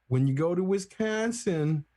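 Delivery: lightly put-on Wisconsin drag on the vowels; Wisconsin accent. Shown as a demonstration. Showing how people talk up in Wisconsin, Wisconsin accent. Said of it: "they drag 'em in a different way".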